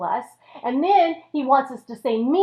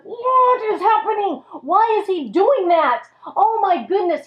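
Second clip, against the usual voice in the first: higher-pitched, indignant disbelief; hammed-up villain's dismay; exasperated disbelief; 7 semitones higher, 3 dB louder than usual. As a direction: act baffled and put out. Devil thwarted by Job's praise.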